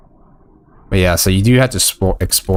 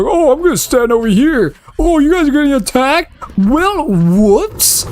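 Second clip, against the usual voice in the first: high, airy mock voice of a clueless teammate; sing-song it like a dopey ally shrugging off the fight; mocking the mage. Gamer mocks his useless mage companion, high, airy mock voice.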